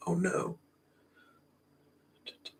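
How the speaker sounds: normal talking voice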